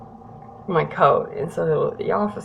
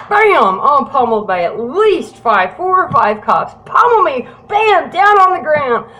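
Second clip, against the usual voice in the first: loud, high, punchy storytelling; animated blow-by-blow, voice jumping up; dramatic re-enactment; 7 semitones higher, 8 dB louder than usual. Retold or imitated. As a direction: belt out each hit of the tackle. Re-enacting being tackled by cops.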